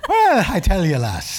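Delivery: hint of an Irish brogue on request; Irish accent. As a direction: tease in a playful Irish accent. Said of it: "Give us the little Irish accent that you have".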